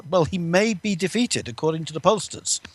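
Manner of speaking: normal talking voice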